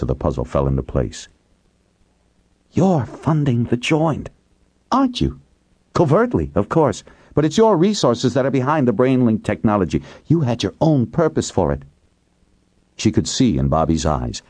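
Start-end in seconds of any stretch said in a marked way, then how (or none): 2.7-11.8 a touch higher, accusing; lightly voiced woman. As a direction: accuse him calmly. Kate voiced in story.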